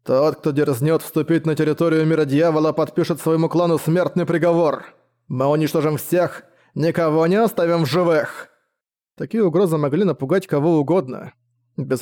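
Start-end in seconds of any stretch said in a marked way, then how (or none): none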